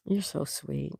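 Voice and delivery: a quiet, touched echo of the thanks; soft murmured repeat. Real, not acted.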